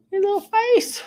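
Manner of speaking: high squeaky cooing voice; overwhelmed by cuteness. Real, not acted. Cooing over a cute figurine.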